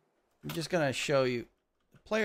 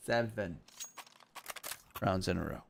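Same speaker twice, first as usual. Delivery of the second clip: softer, lower, with a pause midway; hushed emphasis, falling pitch; low, understated repeat; 5 semitones lower, 6 dB quieter than usual. Real, not acted. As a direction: let it sink in quietly. Low repeat after the big announcement.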